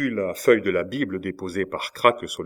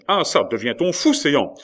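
raised, loud indignant outburst; flaring anger; 6 semitones higher, 7 dB louder than usual. A narrator's reading. Cornelius to whoever bursts in, angry outburst. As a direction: scold the household as if it had gone mad. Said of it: "que l'on nomme la colère".

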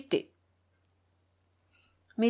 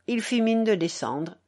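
ordinary delivery

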